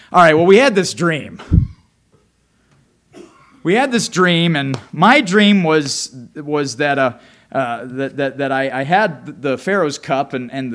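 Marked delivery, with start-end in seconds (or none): 0.1-6.1 louder, eager start to the story; eager telling. Acted. Butler eagerly telling Joseph his dream, louder. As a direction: burst out with the news.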